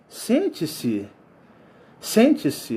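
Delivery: a hint of an elderly lady, gently inviting; old woman voice. Old woman telling the visitor to sit down, lightly voiced elderly tone. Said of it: "com uma voz de idosa".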